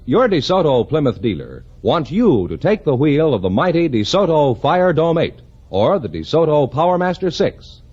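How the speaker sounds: ordinary talk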